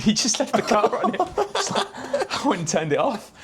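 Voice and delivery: laughing through the words; giggling as he tells it. Real, not acted. Laughing while telling story.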